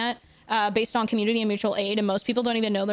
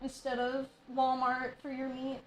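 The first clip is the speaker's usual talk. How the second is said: normal talking voice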